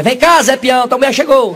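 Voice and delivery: loud, high shout summoning someone far away; calling out. Acted. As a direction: holler it across heaven. Saint Peter calls out across heaven.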